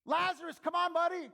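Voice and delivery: high, very loud calling shout; big booming summons, pitched high. Acted. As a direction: shout him out of the tomb. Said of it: "Then he shouted".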